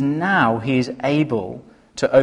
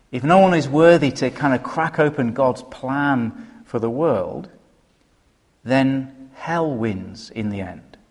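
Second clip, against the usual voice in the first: ordinary talk, nothing marked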